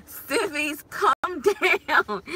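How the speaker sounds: retold giggling plea, breaking up mid-phrase; laughing hard. Mom to toddler on her shoulders, laughing hard. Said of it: "I'm laughing so hard".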